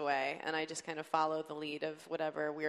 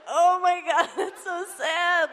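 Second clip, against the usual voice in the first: much higher, louder, pity dissolving into laughter; laughing mock pity; 10 semitones higher, 10 dB louder, slower than usual. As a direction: squeal it through laughter, half sorry. Group reacting to sad guy.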